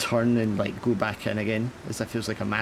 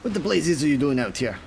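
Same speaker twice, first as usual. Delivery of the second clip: higher captain voice, demanding; gruff captain; 7 semitones higher than usual. Acted. Captain questions the sailor, then wants his whiskey. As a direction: snap for the whiskey like an old captain.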